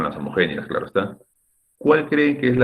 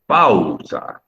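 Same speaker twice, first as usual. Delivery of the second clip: PA-UU-sa, drawn out with A and U equally strong; both vowels equally stressed; 3 dB louder than usual. Shown as a demonstration. Teacher shows how strange equal intensity sounds, A and U stretched in "Pausa". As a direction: stretch "Pausa", giving A and U the same force. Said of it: "con la misma intensidad".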